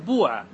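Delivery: بوعة said "buu'a", long uu after the b; damma form. Shown as a demonstration. Teacher answering the question to students, بوعة as "buu'a". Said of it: "بالباء إيش تقول".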